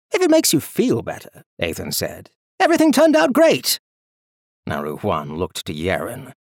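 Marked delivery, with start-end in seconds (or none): 0.1-3.8 much higher, airy nobleman voice; breezy Archlord. Acted. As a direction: toss it off lightly. Ethan's cheerful voice.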